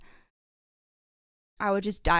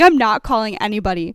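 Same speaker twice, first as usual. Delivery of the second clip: firm refusal; 10 dB louder than usual. Retold or imitated. Friend refusing to call.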